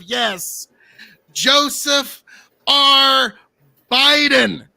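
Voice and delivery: drawn-out announcer build to a loud name; grand guest introduction. Acted. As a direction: announce the guest with mounting fanfare.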